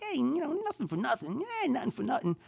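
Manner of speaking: high Jersey "nothing for nothing" with a laugh; Jersey accent. Retold or imitated. Podcaster imitating a Jersey guy with a laugh. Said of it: "And it's like".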